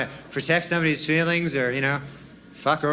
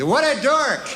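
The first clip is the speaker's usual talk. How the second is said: high, emphatic blurt of a headline; exclaimed headline; 8 semitones higher, 3 dB louder, slower than usual. Acted. Comic reads out the newspaper's headline, high and exclaiming. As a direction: exclaim it higher, with a punch. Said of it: "That was the headline".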